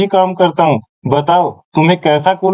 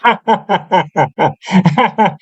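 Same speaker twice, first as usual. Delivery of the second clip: mocking laugh. Shopkeeper laughs at the offer.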